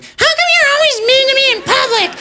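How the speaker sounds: very high, whiny mock girlfriend. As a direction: squeal it like an exaggerated nagging girlfriend. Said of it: "girlfriend voice".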